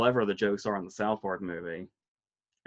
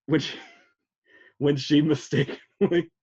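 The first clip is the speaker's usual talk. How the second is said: holding back laughter, louder and higher; trying not to laugh; 4 semitones higher, 4 dB louder than usual. Real, not acted. Recalling a favorite old lady moment, trying not to laugh. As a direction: get it out through rising laughter. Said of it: "Without laughing".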